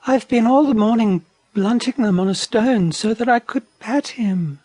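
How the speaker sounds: high, mildly let-down wallaby voice; gently disappointed little wallaby. A narrator's reading. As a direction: say it high, a touch crestfallen. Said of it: "in a disappointed voice".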